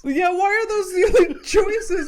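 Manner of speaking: high, loud, laughing through it; giggling at the absurd choice; loud laughing retelling; laughing disbelief. Real, not acted. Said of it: "repeats punchline laughing".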